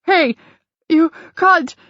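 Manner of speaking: high, breathless cry after being winded; gasping shout. Acted. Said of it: "gasping for breath".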